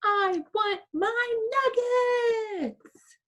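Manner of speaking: put-on high, slow childish whine; stretched-out bratty demand; whiny demand. Shown as a demonstration. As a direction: demand nuggets like a spoiled kid. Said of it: "Which I hope you never say".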